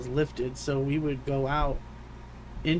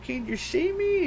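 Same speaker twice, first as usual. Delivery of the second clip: high imitated question. Friend on phone asking, imitated.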